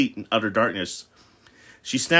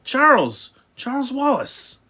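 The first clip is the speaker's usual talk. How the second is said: high, loud alarmed calls; crying out; 6 dB louder, slower than usual. A narrator's reading. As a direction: call out the boy's name urgently. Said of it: "Then he cried out".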